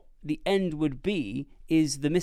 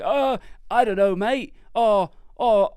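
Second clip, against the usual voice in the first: mocking a panicky Idris Elba in a broad British voice; a dithering British bloke, pitched up; British accent; 3 semitones higher, 6 dB louder than usual. Friend mocks the film's president in a British voice. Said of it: "he does sound quite British for".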